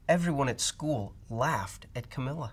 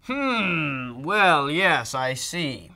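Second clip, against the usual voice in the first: louder musing doctor on phone; mumbled doctor; 3 semitones higher, 7 dB louder, much slower than usual. Acted. A hint of the doctor mumbling on the phone. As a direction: hum and think aloud. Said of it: "Doctor Bumble mumbled".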